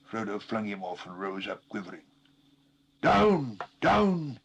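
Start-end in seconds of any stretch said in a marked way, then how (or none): 3.0-4.4 loud, higher, forceful gasp; gasped command. A narrator's reading. Frodo gasps at Gollum to get down. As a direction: push the creature off, breathless. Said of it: "he gasped".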